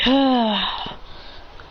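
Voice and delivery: long, breathed-out acted sigh. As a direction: let out a slow sigh. Character sighs on returning.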